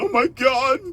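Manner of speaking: very high, loud mocking gasp of shock. Mocking the listeners who act surprised, high and hammed-up. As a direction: ham up a fake shocked bystander. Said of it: "sit back like a dumbass and".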